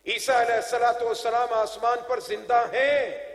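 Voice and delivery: ringing sermon proclamation; preaching at full force. Real, not acted. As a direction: proclaim each line to a big congregation. Preaches to a crowd.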